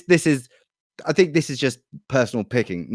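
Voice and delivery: ordinary delivery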